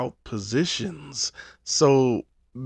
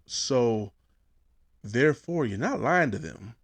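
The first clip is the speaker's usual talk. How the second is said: ordinary delivery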